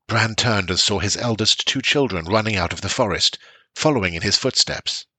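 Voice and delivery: plain reading voice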